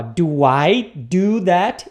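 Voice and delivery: very slow, rising question; slowed-down example. Shown as a demonstration. Teacher showing the two do's, very slow. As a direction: separate every word and lift the end. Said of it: "Então, ó".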